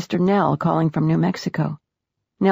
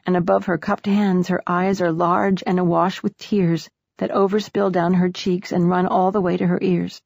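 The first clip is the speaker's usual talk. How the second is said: ordinary delivery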